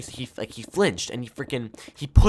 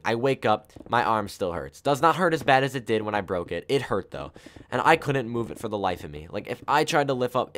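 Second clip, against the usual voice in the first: usual speaking voice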